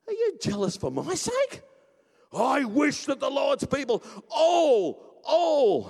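high, loud rebuke building to a holler; exclaiming Moses. Acted. Moses wishes all the Lord's people prophesied, exclaiming fervently. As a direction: punch "all" out loud and drawn out.

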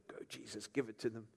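whispered, soft cheering on; unvoiced quiet cheer. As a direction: egg Jesus on under the breath. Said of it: "you'd be going".

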